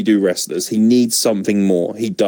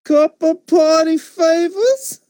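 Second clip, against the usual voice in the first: mock Enzo; much slower than usual. Repeating the mock Enzo line.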